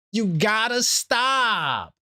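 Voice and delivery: slow, drawn-out shout; a player scolded for his tweets, in a slow shout; scolding shout. Real, not acted. Scolding Mitchell Robinson directly.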